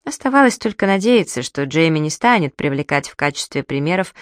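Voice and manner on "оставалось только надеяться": usual speaking voice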